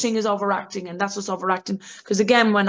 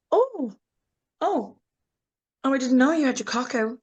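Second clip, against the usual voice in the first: shocked gasp; 4 semitones higher than usual. Retold or imitated. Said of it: "you'll get a shocked Stacy".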